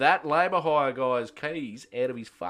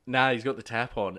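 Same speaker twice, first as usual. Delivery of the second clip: ordinary talk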